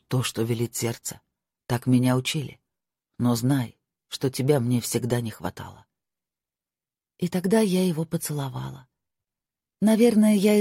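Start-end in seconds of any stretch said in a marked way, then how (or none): 0.1-5.8 put-on hushed, lowered young lord. Marko voiced by narrator.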